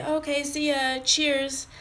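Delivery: high-pitched imitated goodbye; high, sing-out parting call; delivery person's sign-off. Imitates delivery people saying goodbye.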